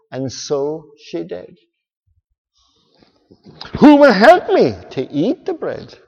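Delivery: lively storybook read-aloud; reading to children. Acted. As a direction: read it like a bedtime story to kids.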